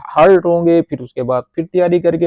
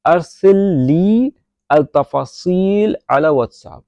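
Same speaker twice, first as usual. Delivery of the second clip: slow model sentence; 2 semitones higher, much slower than usual. Shown as a demonstration. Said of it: "تو اس کو Arabic میں بولیں گے".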